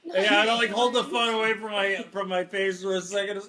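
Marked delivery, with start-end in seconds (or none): none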